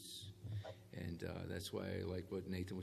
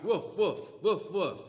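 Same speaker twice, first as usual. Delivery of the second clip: high, loud dog barks; four loud woofs, mock dog; barking like a dog; 9 semitones higher, 9 dB louder, slower than usual. Shown as a demonstration. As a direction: bark like a playful dog. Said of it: "I can stand up here and bark".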